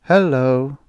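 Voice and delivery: bright, mechanical-sounding trained greeting; a robot's programmed cheerful hello; cheery robot hello. A narrator's reading. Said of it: "respond with a cheery".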